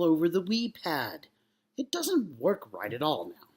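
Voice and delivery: stagey captain, talking to the commander; captain character. Acted. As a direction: overact a grossed-out space captain. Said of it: "this is the captain character".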